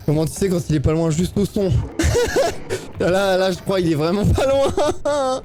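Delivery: spooked and trying to laugh it off; scared giggle. Real, not acted. Panique, le monstre est proche. (voix aiguë et nerveuse, mi-rieuse)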